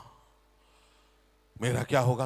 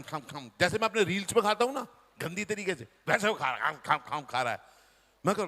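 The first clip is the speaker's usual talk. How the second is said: ordinary talk, nothing marked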